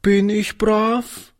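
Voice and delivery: high, slow and timid; a small child asking, afraid of the answer; mildly fearful child's question; anxious little girl. A narrator's reading. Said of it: "fragte sie ängstlich".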